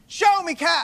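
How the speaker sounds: loud catchphrase shout; game-show call. Acted. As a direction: call it out like a quiz host.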